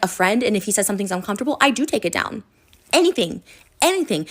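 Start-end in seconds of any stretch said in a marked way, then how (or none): 2.9-4.3 higher and louder, insistent; Anything said high and loud twice; emphatic exclamation. Real, not acted. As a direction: hammer the point home. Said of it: "emphatic repeat".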